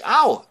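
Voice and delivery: sudden loud, high ow; mock pained yelp. Suitor hurt by the bowstring, loud mock yelp. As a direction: yelp as if snapped by string.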